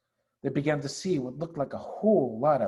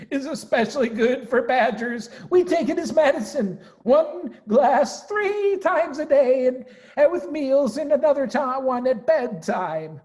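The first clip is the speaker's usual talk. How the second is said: chuckling Badger; 11 semitones higher, 6 dB louder than usual. Badger chuckling about cider.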